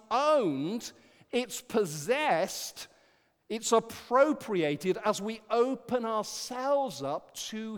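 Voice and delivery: slow, deliberate and loud; slow emphasis. Acted. Preacher stressing how freedom is owned, slow. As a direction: lay each phrase down slowly.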